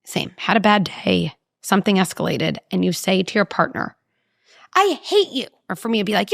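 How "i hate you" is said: high mock outburst of a hurt kid; imitating a kid shouting I hate you; angry kid yell. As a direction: fling it like a furious child. Angry kid quote acted.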